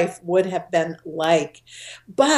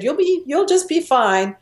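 breezy brush-off; 8 semitones higher than usual. Retold or imitated. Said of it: "the old me would have said a quick prayer".

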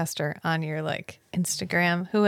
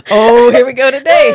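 mock eureka; 5 semitones higher, 18 dB louder than usual. Podcaster imitating an author struck by inspiration, mock excited shout. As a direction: call it out big, half laughing. Mimics author getting an idea, loudly.

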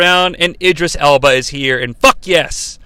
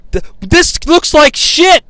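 exasperated rant; 10 semitones higher, 4 dB louder, slower than usual. Real, not acted. Ranting about the costume.

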